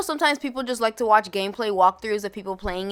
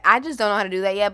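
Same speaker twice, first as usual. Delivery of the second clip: ordinary talk, nothing marked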